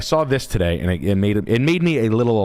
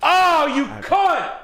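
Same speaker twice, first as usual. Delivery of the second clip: slow pained yell, high-pitched; pained curse; much slower than usual. Acted. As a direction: swear at the desk you hit. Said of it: "I just banged my knee on my desk".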